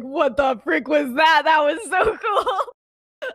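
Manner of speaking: high, loud thrilled outburst; freaking out. Retold or imitated. Emmeline to Remus after flying, acted thrilled freak-out. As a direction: burst out in hammed-up amazement. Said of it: "freaking out".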